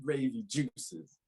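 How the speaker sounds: slow, quiet teasing repeat; drawn-out mock repeat of a friend's phrase; mocking echo. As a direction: savor the words like they're ridiculous. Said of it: "Who says that?".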